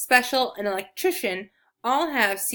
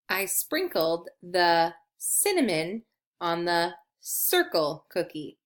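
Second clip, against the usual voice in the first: slow, lower, word-by-word reading; slow model reading; 4 semitones lower, slower than usual. Shown as a demonstration. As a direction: read it out slowly for learners to follow. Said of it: "let's try reading".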